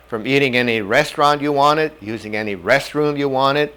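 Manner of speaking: normal talking voice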